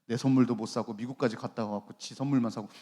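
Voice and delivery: low, put-on grumbling; mock resentful grumble. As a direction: grumble to yourself as if begrudging a gift. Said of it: "이렇게 선물 샀을까요?".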